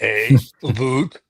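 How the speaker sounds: Canadian "boot" for about; mock Canadian accent. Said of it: "do his best Canadian accent".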